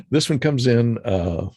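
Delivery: normal talking voice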